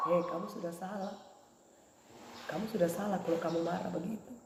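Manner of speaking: soft, low inner voice of the Holy Spirit; voice of conscience. Acted. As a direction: gently scold from inside the heart. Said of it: "Ini Roh Kudus bicara terus di hati nuraniku".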